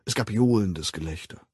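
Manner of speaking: ordinary delivery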